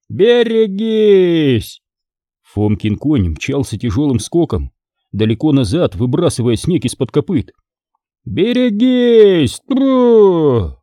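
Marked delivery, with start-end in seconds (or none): 0.1-1.8 much higher, slow calling shout; drawn-out warning shout. Acted. 8.2-10.8 much higher, slow calling shout; drawn-out warning shout. Acted.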